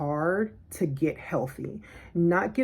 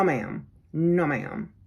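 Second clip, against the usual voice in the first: usual speaking voice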